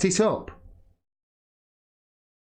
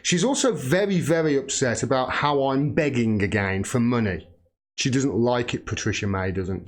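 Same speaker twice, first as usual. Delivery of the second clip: normal talking voice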